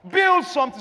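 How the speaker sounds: high, loud call; exhorting. Acted. Pastor urging the congregation to build, loud. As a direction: call it out to the room. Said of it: "Somebody say".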